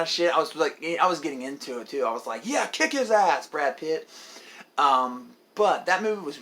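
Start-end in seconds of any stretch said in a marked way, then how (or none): 2.4-4.1 raised, mildly excited egging-on; root for the hero, easing off toward the end; retold cheering. Moviegoer egging on Brad Pitt, light cheer.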